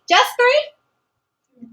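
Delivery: teacher playfully doubting a student's answer, mock surprise; mock disbelief.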